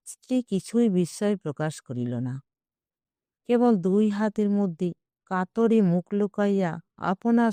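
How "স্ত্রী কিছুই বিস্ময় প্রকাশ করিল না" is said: plain reading voice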